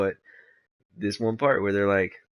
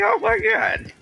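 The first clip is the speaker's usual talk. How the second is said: laughing disbelief; 6 dB louder, slower than usual. Real, not acted. Laughing at the clip.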